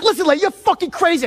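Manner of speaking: high-pitched angry outburst; retold meltdown at the other person; freaking out. Customer snapping at the insurance agent, freaking out. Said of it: "I start freaking out".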